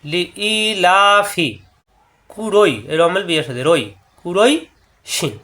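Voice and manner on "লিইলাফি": লিইলাফি with লি and লা each held one count; one alif stretch. Shown as a demonstration. Teacher modelling the stretch, slow. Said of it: "লা একালিপ টান".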